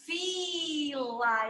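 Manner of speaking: soft, elongated "Fila"; stretched sound. Shown as a demonstration. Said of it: "Eu estico esse som".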